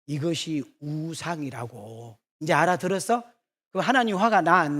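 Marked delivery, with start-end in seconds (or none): 0.1-2.2 slow, softer, dropped low to land the point; slow quiet punchline. Real, not acted. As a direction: let it sink in slowly and quietly. Punchline after the outburst. (느리고 낮게 힘주어 정리하는 말)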